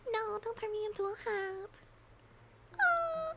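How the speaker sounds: very high, crying little plea; high mock-crying plea to Mister Wolf; squeaky pleading victim. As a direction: beg the wolf in a tiny wailing voice.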